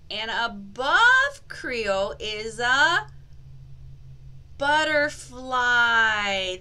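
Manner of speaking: slow, stretched reveal with a pause; suspenseful reveal. Acted. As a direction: build suspense before the word.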